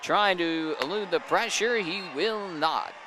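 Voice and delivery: high play-by-play call; live play-by-play. Real, not acted.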